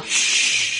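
sharp shush; shushing hiss. Retold or imitated. Hushing friends during call.

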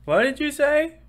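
high loud "Huh?"; mock confused listener. Annoyed listener asks him to repeat, high and loud. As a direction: squawk it like someone who missed it. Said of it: "somebody's like".

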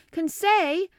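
loud call across the room. A narrator's reading. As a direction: call his name out loudly. Narrator calls servant by name.

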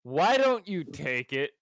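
exasperated scolding. Real, not acted. Friend questioning a missed chance to flirt, exasperated. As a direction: demand an answer from a hopeless friend.

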